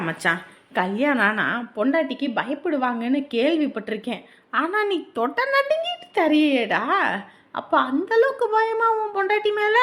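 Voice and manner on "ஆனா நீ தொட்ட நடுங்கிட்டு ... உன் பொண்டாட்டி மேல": high, squealing tease; mocking disbelief. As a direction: taunt your friend as scared of his wife. Mocking friend's fear of his wife.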